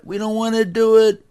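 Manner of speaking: whiny-kid style objection, acted; mock protest. Acts group refusing the command.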